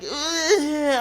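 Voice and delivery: high-pitched groan of distaste; mock disgust. Host voicing viewers who disliked the promo, mock disgust. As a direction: imitate a put-off viewer.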